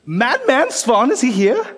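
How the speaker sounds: drawn-out wide-eyed "Madman Svan"; excited. Acted. Player asks in character.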